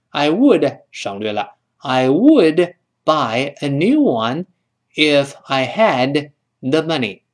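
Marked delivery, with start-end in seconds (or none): 1.8-7.2 slow, stretched-out teacher's reading; careful English with a pause between phrases; slow model sentence. Shown as a demonstration. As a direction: draw each word out for the class. Teacher models full sentence slowly.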